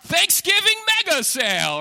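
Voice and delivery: high, loud hammed-up sale pitch; parodying a store ad; mock ad announcer. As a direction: shout it like a cheesy TV commercial. Mocking the furniture store ads.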